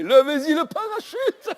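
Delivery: high, loud, slow yell; shouting after them. Retold or imitated. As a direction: call out across the field. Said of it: "on gueulait".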